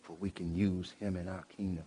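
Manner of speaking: a hushed, humble plea retold; asking voice. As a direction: ask it gently, as if begging the Father. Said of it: "it was an asking voice".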